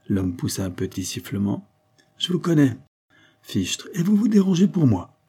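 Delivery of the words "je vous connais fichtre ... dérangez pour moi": high-pitched, lively astonishment; impressed surprise. Acted. Suspect recognises the famous police commissioner, impressed and surprised.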